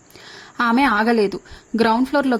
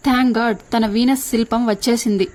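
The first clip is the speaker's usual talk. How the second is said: usual speaking voice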